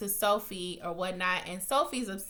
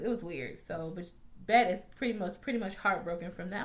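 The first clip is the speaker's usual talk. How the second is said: normal talking voice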